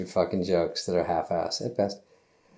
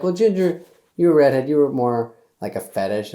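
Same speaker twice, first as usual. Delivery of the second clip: high, loud patronizing tone; mock condescending voice; 4 semitones higher, 5 dB louder than usual. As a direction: talk down to her sweetly. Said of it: "they were like".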